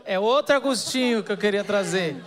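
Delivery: laughing. Real, not acted. Speaker joking about the wrong Agostinho, laughing. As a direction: laugh your way through the correction.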